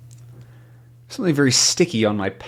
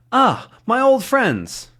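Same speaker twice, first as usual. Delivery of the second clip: mock greeting; 7 semitones higher than usual. Voicing character greeting police.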